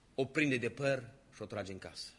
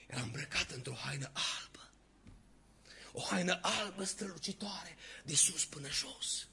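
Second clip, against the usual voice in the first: hushed awe; 2 semitones higher, 5 dB quieter than usual. Acted.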